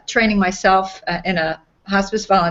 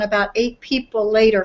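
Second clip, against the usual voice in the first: ordinary delivery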